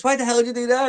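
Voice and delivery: hammed-up young John; mock kid protest. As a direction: squeal it like an outraged kid. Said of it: "He goes".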